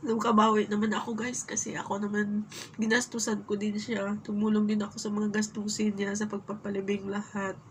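ordinary talk, nothing marked

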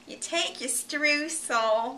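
slow, drawn-out teacher voice with a rising end; lightly voiced old home ec teacher; imitating home ec teacher. Mimicking her home ec teacher's lesson, slow and drawn out. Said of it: "That's exactly how she talked".

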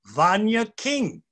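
usual speaking voice

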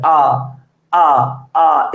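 আ repeated as separate, open central vowels; vowel demonstration. Shown as a demonstration. Teacher shows where the sound comes from, open আ demonstration. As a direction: isolate each আ with a pause between. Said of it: "উচ্চারণটা এখান থেকে আসতেছে".

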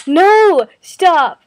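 sudden loud yell of No and Stop; real shout. Real, not acted. Yelling at the stepsister listening in, real loud shout. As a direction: shout her out of the room.

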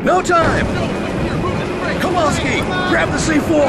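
high, loud urgent yelling; frantic commander shouting everyone out; shouting over chaos. Acted. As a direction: bark orders over the roar of a crash. Escaping a crashing plane.

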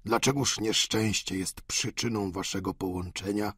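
put-on a ruined old father vowing he'd steal for her; old Goriot, desperate. Goriot voiced.

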